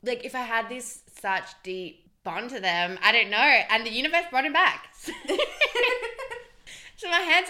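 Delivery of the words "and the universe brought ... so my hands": laughing. Real, not acted. Laughs while joking.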